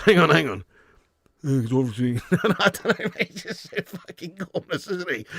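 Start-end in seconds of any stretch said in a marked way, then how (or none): 1.4-2.2 nasal footballer voice; Harry Kane. Acted. Streamer having another go at the footballer, nasal impression. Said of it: "He speaks through his nose". 2.6-5.3 high, laughing through the words; cracking up. Real, not acted. Streamer breaking out of the Harry Kane impression, laughing.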